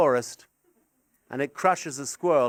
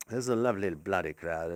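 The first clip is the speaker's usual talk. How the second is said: lower, quicker character voice; put-on voice; 11 semitones lower, 9 dB quieter, faster than usual. Shown as a demonstration. Comic praising the crowd in a silly put-on voice. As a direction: rattle it off in a silly voice. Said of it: "Do you do that thing".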